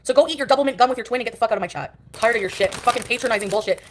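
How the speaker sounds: normal talking voice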